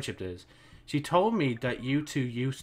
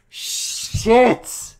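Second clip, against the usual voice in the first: shocked hiss; 10 semitones higher, 9 dB louder than usual. Real, not acted. Shocked reaction to game twist.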